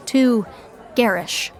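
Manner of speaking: ordinary delivery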